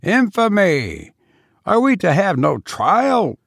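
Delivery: indignant cry. A narrator's reading. A conspirator protests to the captain, outraged. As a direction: protest the injustice at full pitch. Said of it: "cried one who stood beside Gismondi".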